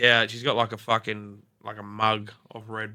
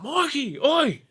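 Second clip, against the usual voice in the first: high, raised call to get his attention; calling out sharply; 4 dB louder, slower than usual. Retold or imitated. Trying to get through to sleepwalking Mikey, calling out sharply. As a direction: holler at a dazed mate.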